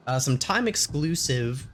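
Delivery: ordinary talk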